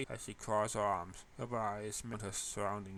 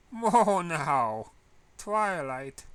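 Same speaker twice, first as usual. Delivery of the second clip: grinning tease; 5 semitones higher, 11 dB louder, much slower than usual. Acted. Teasing friend with grin.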